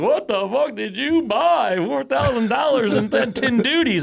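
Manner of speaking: high, loud, drawn-out mock outrage; incredulous outburst. Driver walks in with the customer's package, incredulous outburst. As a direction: imitate a flabbergasted driver, loud and slow.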